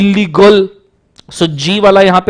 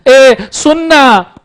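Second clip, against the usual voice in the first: slow, stretched pleading call; drawn-out plea; 5 semitones higher, much slower than usual. Shown as a demonstration. Teacher acting a slow drawn-out plea as an offer. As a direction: drag out every syllable. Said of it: "हर emotion में offer ही है".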